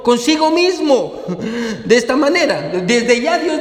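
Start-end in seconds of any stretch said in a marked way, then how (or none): 0.0-1.1 "consigo mismo" drawn out slowly and higher; énfasis subrayado. Shown as a demonstration. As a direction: underline "consigo mismo", stretching it at a raised pitch. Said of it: "quiero que subraye eso". ("consigo mismo" subrayado, lento y más agudo)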